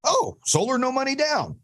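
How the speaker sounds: imitating a hooked reader. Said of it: "someone who's just reading sports goes".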